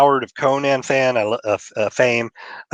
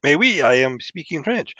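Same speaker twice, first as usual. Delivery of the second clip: exaggerated, higher-pitched French accent; thick French accent; 5 semitones higher than usual. Shown as a demonstration. Speaker showing how they got through French class, thick mock French accent. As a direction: lay on a hammed-up French accent. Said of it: "I said it with a thick accent".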